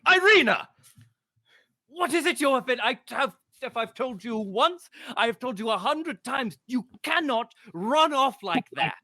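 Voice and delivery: high, loud, exasperated scolding; a finely dressed man scolding Irina; scolding father. Acted. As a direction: call out a runaway child sharply.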